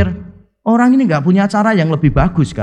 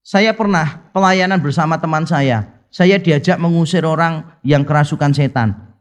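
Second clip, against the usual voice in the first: usual speaking voice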